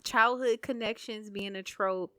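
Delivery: ordinary delivery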